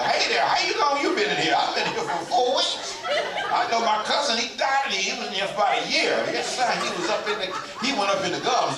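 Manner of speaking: loud, high comic banter; chatting food scraps. Acted. Food stuck in teeth talking to each other, loud comic voices. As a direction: play chummy old bits of food swapping news. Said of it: "They talk to each other".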